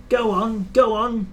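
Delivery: an impatient engine's puffing urge; eager puffing. A narrator's reading. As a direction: push him on in a couple of hurried puffs. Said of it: "he puffed to Edward".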